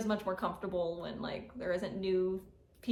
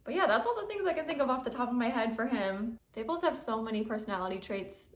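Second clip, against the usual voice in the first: ordinary talk, nothing marked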